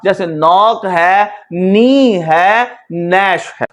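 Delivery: नॉक, नी and नैश said slowly with no g or k sound; patient teacher showing silent letters; silent letter demo. Shown as a demonstration. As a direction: model each word slowly, initial letter silent. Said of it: "मिसाल देखिएगा".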